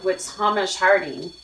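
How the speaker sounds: ordinary talk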